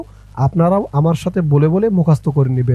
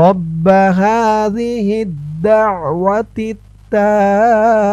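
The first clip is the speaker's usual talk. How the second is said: slow melodic Arabic recitation; 3 semitones higher, much slower than usual. Shown as a demonstration. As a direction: chant the phrase slowly and sweetly. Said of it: "শব্দে শব্দে". (ধীরে সুরেলা আরবি তিলাওয়াত)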